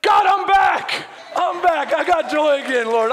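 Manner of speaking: loud, high cry of relief to God; exultant retold shout, easing after the first cry; joyful outburst. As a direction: shout it out in jubilation. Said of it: "I just started laughing. And I go".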